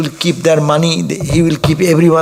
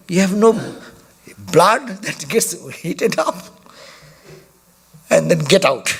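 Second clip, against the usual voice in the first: mock angry tirade, pitched higher; half-played rebuke of an unflappable disciple; retold fiery scolding; 5 semitones higher than usual. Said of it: "started scolding".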